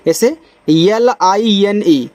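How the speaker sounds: letters L, I, N, E spelled slowly one by one; spelling out letters. Shown as a demonstration. Teacher to class, spelling out the word slowly. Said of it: "तो इसको पढ़ेंगे लाइन".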